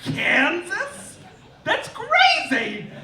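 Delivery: mock outrage climbing to a laughing squeal; dead civil rights leader baffled, rising to a shriek; Martin Luther King from the grave. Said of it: "Martin Luther King just sat up in his grave and was like".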